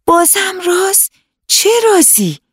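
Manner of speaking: eyes lighting up at another secret; excited girl. Acted. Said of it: "چشمای حنا برقی زد".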